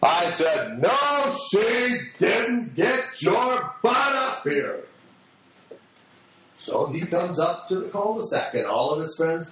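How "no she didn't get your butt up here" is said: higher, drawn-out yelled order, retold; stern angry dad. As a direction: overrule the boy like a fed-up father. Angry father overrules his son.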